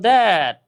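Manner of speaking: "that" drawn out slowly with a long vowel; pronunciation of that. Shown as a demonstration. Teacher answering what the pronunciation was, slow careful demo. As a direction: stretch that, tongue starting on the teeth. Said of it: "এটার উচ্চারণ ছিল".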